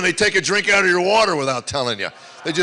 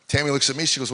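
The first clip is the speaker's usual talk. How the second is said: ordinary delivery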